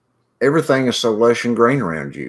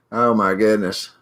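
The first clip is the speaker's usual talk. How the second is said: usual speaking voice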